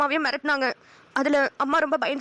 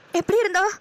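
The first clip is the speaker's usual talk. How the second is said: higher, crying question; tearful; 5 semitones higher than usual. Acted. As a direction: ask through tears. Asks about her sister. (அழுகையுடன்)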